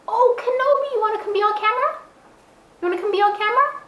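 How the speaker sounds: high, fast cooing at the dog. Real, not acted. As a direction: coax the dog in a sing-song voice. Calling the dog sweetly.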